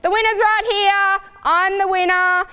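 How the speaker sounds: mock stallion bragging after coming last; cocky stallion. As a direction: crow like a stallion who thinks he won. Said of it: "screaming his head off".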